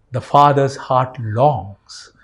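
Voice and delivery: normal talking voice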